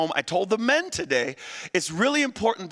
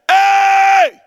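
much higher, loud shout; 11 semitones higher, 11 dB louder, slower than usual. Acted. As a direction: bellow it at the crowd. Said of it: "Jesus said".

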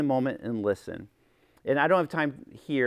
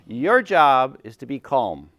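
ordinary talk, nothing marked

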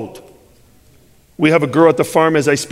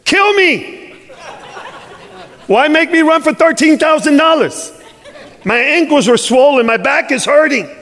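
much higher, mock-anguished complaint to the Father; hammed-up despairing prayer, laughing through it; exasperated plea to God; 11 semitones higher than usual. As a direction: whine at God like a worn-out son. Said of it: "I have a discourse with my father".

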